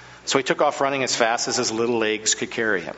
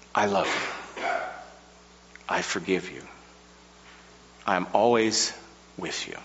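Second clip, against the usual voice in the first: soft, tender divine reassurance fading to near silence; a lightly voiced loving God; God's tender promise; 4 semitones lower, 4 dB quieter than usual. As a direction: speak it like a gentle whisper from above.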